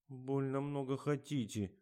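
sulky actress talking back; pouting complaint. A narrator's reading. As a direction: grumble through a pout. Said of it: "оттопырила нижнюю губу Катя".

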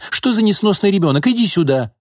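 high-pitched exasperated scolding; exploding in anger. A narrator's reading. Mother scolding her stubborn daughter, exploding in anger. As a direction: order the child over, fed up. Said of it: "взорвалась Нина".

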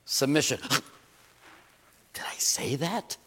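gasping in mock horror, then a hushed aside. Preacher mocking the congregation's shock, gasping whisper. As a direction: act scandalized by the word.

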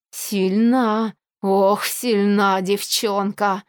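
usual speaking voice

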